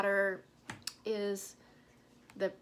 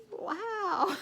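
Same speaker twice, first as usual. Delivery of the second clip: much higher, louder disbelieving wow; second wow, pitched way up in mock shock; incredulous wow; 11 semitones higher, 3 dB louder than usual. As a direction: let the disbelief climb. Stunned reaction to mean replies.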